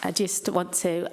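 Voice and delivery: ordinary talk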